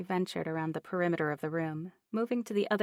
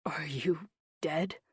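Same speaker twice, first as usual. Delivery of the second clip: hoarse whisper; slower than usual. Acted. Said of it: "His voice dipped to a hoarse whisper".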